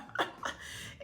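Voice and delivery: quiet airless giggle fading; soft breathless laugh. Real, not acted. Reader settling down after crying, breathless laugh. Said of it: "I'm so emotional".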